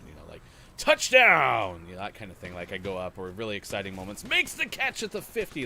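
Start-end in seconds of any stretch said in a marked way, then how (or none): 0.8-1.8 big booming touchdown call, much louder; play-by-play touchdown call. Shown as a demonstration. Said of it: "like when I'm doing touchdown". 4.2-5.6 much higher, excited commentator lift on "Makes the catch at the fifty"; excited play-by-play. Shown as a demonstration. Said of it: "my voice goes up".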